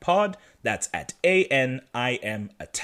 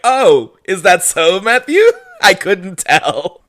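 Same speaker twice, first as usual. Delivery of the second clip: loud, high, laughing sarcasm; mock surprise; 7 semitones higher, 8 dB louder, slower than usual. Host teasing lovesick Matthew, with loud sarcastic surprise. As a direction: feign astonishment loudly, cracking up by the end.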